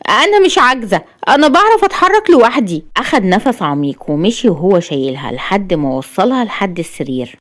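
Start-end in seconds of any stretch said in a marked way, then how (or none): 0.1-2.8 high, upset protest; frustrated woman objecting; annoyed. A narrator's reading. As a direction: insist on independence in a raised pitch. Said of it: "قالت رؤى بضيق".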